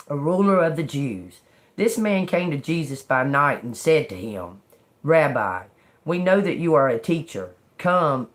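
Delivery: measured Bible reading voice; scripture reading. Acted. Reading John to viewers, steady scripture reading. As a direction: read the verses steady and earnest.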